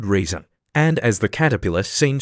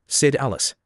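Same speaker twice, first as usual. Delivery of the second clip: ordinary delivery, nothing marked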